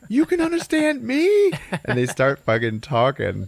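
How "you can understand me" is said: loud, very high shriek; high, loud, over-the-top amazement; mock astonishment. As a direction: shriek the question in comic disbelief. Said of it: "And he's like".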